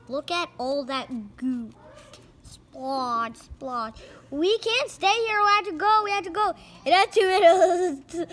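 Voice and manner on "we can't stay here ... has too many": high loud frantic cartoon shout; hammed-up panic. Crook shouting at the gang to flee. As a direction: yell it in cartoon terror.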